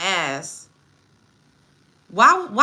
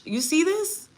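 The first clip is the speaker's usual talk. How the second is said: incredulous; 8 semitones higher, slower than usual. Real, not acted.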